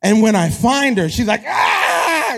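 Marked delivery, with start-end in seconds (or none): 1.5-2.4 high, drawn-out playful shriek; mock scream of delight; imitated toddler scream. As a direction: squeal like a delighted kid being found. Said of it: "she just screams".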